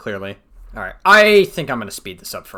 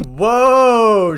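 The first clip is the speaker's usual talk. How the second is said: long, drawn-out whoa, much higher and louder; surprised whoa; 10 semitones higher, 9 dB louder than usual. Real, not acted. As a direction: let it slide down in mock awe. Reacting to gym jacket tweet.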